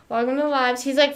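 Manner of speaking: ordinary talk, nothing marked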